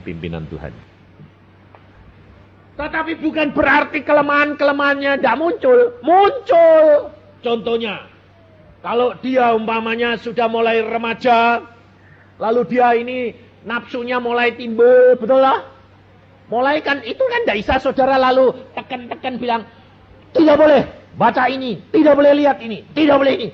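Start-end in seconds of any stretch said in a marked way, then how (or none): 2.8-19.6 loud, driving sermon delivery, pressing faster; preaching at full force. Acted. Preacher rousing the congregation, loud and pushing. 20.3-23.5 loud scolding parent forbidding everything; mock strict parent. Preacher mimicking a parent scolding a teen, loud and bossy.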